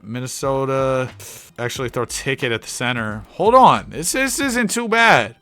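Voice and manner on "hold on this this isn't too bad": high-pitched, slowing realization; surprised hope. Real, not acted. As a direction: realize things are looking up. Spots a promising pick.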